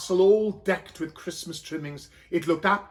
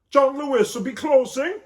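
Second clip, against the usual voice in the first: higher, louder nagging husband; impatient Joseph; 8 semitones higher, 7 dB louder than usual. Acted. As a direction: hurry her along, impatient. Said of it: "said Joseph".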